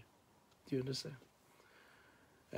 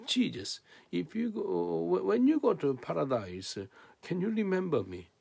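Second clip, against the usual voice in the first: acted plea; 8 semitones higher, 5 dB louder than usual. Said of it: "he asked Jesus".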